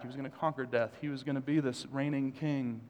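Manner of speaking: normal talking voice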